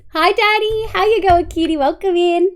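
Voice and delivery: usual speaking voice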